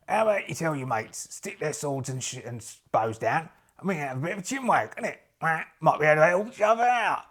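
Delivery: slangy geezer sales patter; matey adventurer. Acted. Adventurer offering the merchant cheap goods, matey geezer patter. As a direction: pitch it like a chummy wheeler-dealer.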